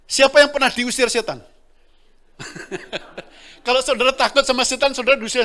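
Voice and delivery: loud, high preacher's call to the room; preaching at full force. Acted. Preacher asking the church who cast out demons, loud rousing call. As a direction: rouse the congregation with each question.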